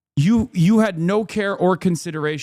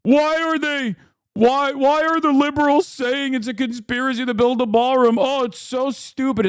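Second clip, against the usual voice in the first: mock the crying Republicans in a whiny voice; 7 semitones higher than usual. Streamer mocking crying Republicans, whiny high voice.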